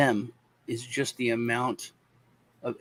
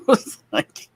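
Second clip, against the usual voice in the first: ordinary talk, nothing marked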